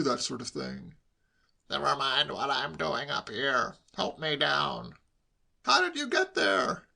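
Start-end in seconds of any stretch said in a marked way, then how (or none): 1.7-5.0 put-on slow, grumpy older-relative voice; irritable uncle. A narrator's reading. Stranded uncle to his nosy nephew, irritable and slow. 5.6-6.9 high, slow, wondering voice; inquisitive nephew. A narrator's reading. Nephew to uncle stuck up high, inquisitive.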